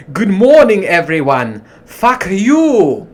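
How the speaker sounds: loud, high hammed-up Coming to America accent. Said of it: "say it in an accent".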